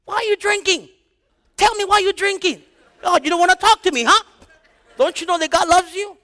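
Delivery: loud, high-pitched demanding yell; acted-out badgering, voice pushed way up; mock interrogation. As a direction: yell at someone who won't answer. Acts out scolding a dead man.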